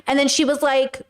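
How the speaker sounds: ordinary talk, nothing marked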